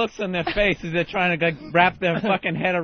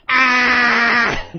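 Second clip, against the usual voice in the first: loud, high mock cackle; maniacal laugh; 4 semitones higher, 7 dB louder than usual. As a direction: blare out an obnoxious clown's laugh. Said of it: "he just had this maniacal laugh".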